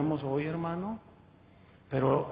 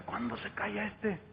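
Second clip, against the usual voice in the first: much softer impatient grumble; under your breath, bored; muttered aside; 8 dB quieter than usual. Retold or imitated. Bored newcomer grumbling during a long sermon, quietly. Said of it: "yo decía".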